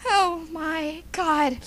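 very slow, stretched-out exclamation; slow motion. Acted. Still stuck in the slow-mo gag, slow motion. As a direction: drag every word out as in slow motion. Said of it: "in that slow-mo".

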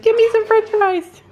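high, loud squeaky bird voice; voicing the bird. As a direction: mom speaking for the bird that wants French fries, high and loud. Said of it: "He's like".

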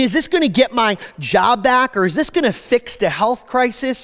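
ordinary delivery

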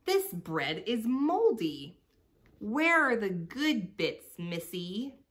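greedy rat demanding better scraps; raspy bully rat. A narrator's reading. Trixie the rat demands food from Celeste, mock raspy. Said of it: "her raspy voice wheezing".

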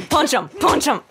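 loud, high, gleeful cheer; excited shouting. Real, not acted. Player cheering on her own punches, excited yell. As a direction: shout it out, thrilled to be winning the fight. Excited gamer beating enemies.

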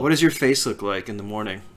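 low deadpan question; dry, grumpy and odd; dry prickly grumpy voice. Retold or imitated. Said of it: "I'm talking like Cactus".

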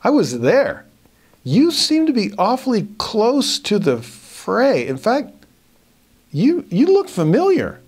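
high-pitched, drawn-out suspicious witness; accusing eyewitness. Acted. Servant recognizes Peter from the garden, high and accusing. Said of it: "now we've got an eyewitness".

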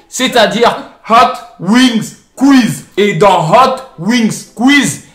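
slow, drawn-out loud hype; announcer hype. Acted. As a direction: announce it like a show host. Hypes the video topic.

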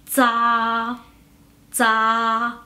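"za" as a buzzing dz plus a long level a; Mandarin ZA. Shown as a demonstration. Teacher combining Z and A for learners, "za" drawn out level. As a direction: hold "za" on one even pitch, starting with unaspirated dz. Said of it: "Es el sonido de la z".

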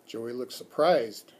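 ordinary delivery

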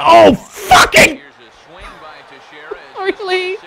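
frustrated shout at the pitcher; angry outburst. Real, not acted. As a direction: drag out the curse in loud frustration. Pitch goes wrong.

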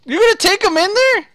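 high, loud disbelief; shocked outcry. Real, not acted. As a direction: yelp it out in alarm. Alarmed at risky portal plan.

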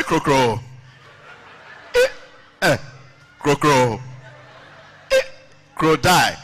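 mock stammer, stuck on "crocro"; stammering teacher. Teacher reads crocodile off the board, mock stammering. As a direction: block on "crocro", then push out the word. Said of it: "he's a conch stammerer".

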